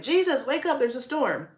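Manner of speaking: imitating disciples frantic in the storm; mock panic. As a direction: play the panicking disciples, hammed-up. Panicked disciples voiced in retelling.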